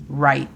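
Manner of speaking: "right" said the English way, r then rising ai. Shown as a demonstration. Teacher models "right" for Spanish learners, English pronunciation. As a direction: say "right" like the English word, one clean syllable. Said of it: "La pronunciación fonética es R A I T".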